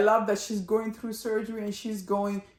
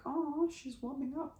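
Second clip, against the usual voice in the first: high, much softer cooing; delighted aside; 8 semitones higher, 10 dB quieter than usual. Retold or imitated. Viewer reacts to the mother softening, high soft coo. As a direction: coo it like a pleased secret. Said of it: "I'm like".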